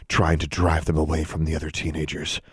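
plain reading voice

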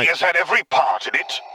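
irritated dark lord snapping; snapped. A narrator's reading. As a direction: snap it back over the shoulder. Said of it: "Vader snapped".

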